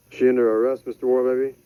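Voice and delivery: usual speaking voice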